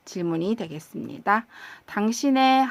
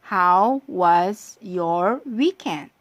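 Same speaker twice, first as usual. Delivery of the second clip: very slow, stretched How was your weekend; slow practice read; much slower than usual. Shown as a demonstration. 주말 인사를 천천히 늘여 읽어 주는 선생님. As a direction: draw each word out for repetition. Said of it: "자, 발음해 볼까요?".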